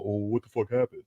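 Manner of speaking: imitating a stunned rival fan, dropping quiet; mock stunned fan. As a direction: play the shell-shocked rival fan. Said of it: "y'all gonna be sitting here going".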